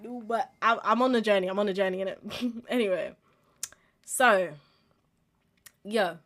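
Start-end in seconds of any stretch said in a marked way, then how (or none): none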